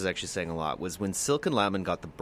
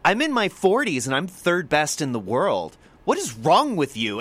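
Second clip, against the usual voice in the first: louder, higher indignant retort, imitated; Silken Lemon berating; 5 semitones higher, 5 dB louder than usual. Rower rounding on the reporter, louder imitated indignation. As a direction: play an outraged athlete snapping back at a dumb question. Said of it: "berating the reporter".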